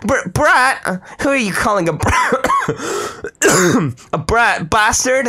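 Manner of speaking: high, loud outraged splutter; offended Mordred. Acted. Knight insulted at being called a brat, high and loud.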